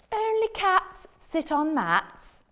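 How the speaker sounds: slow, high know-it-all cat; bossy cat. Acted. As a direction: lecture the frog slowly. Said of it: "said the cat".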